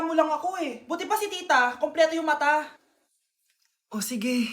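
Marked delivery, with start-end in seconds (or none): 3.9-4.5 whispered, soft resignation; hurt whisper. Acted. Hurt mother whispering her acceptance to her daughter. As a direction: breathe it out, defeated.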